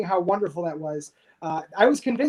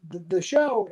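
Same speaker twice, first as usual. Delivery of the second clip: ordinary talk, nothing marked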